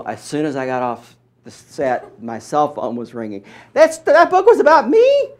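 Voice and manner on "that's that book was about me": high, loud mock outrage; mother's shocked voice. Mother phoning son after TV, high loud shock. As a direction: shriek it as mama.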